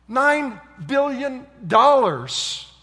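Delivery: loud, high, very slow; amazed emphasis. Acted. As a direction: hammer out each word of the huge sum. Marvels at the canceled sum.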